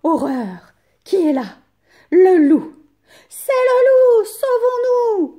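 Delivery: very high, loud panicked screams; little goats shrieking in fear; terrified cries. A narrator's reading. Kids discovering the wolf, screaming high and loud. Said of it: "crient les chevreaux".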